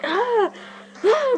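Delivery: two high, loud cries; re-enacted scream. Vlogger re-enacting bushes hitting her face, high startled scream. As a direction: shriek as if struck.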